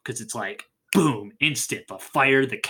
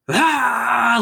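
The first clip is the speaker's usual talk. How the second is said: loud, higher hammed-up scream; mock scream; 9 semitones higher, 5 dB louder than usual. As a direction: let out a big sitcom scream. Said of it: "another big Ben Foster scream".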